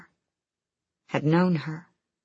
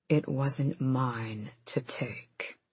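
whisper; 4 semitones lower, 9 dB quieter, much slower than usual. Acted. Lorcan's quiet answer.

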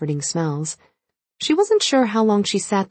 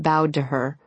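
plain reading voice

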